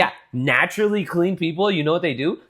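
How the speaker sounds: high, loud mocking lecture; exasperated. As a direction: lecture her, fed up. Retold reply, heated.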